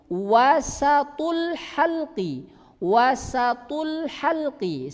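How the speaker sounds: wasatul halqi slowly, sin with fathah; careful wasatul halqi. Shown as a demonstration. Teacher models the Arabic term. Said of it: "sinnya fathah".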